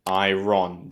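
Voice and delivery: "iron" as eye-RON; wrong iron. Shown as a demonstration. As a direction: sound the r strongly in "iron". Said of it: "we don't say iron".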